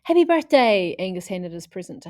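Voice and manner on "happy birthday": loud, high birthday cheer; excited birthday shout. Acted. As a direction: cheer it out at the party.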